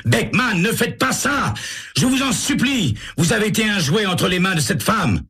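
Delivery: high, frantic begging; detective pleading with a man holding a gun, frantic; desperate plea. A narrator's reading. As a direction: beg him not to shoot. Sam bursts in, pleading.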